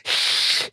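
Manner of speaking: voiceless hiss like a cat. Shown as a demonstration. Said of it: "is you hiss like a cat".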